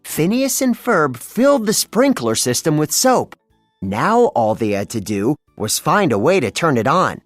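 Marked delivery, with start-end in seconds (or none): none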